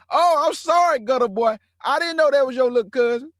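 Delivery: high, pleading grovel, mocking; mock scared apology. Teller mocking the fake hard boy's apology again, high mock grovel. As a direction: squeak out the sorry like a scared tough guy. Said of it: "He just was acting hard".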